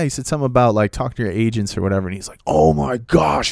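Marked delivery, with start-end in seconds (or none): 2.4-3.5 slow, drawn-out mock exasperation; imitated outrage, slow and raised in pitch; irate. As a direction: stretch it out in higher-pitched disbelief. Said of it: "he just starts getting irate".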